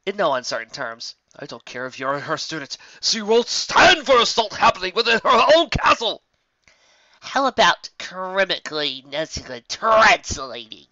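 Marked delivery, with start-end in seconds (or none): 1.4-6.2 indignant threat. Acted. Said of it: "Glitter Squall said". 7.2-10.9 sarcastic retort. Acted. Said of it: "Trixie asked".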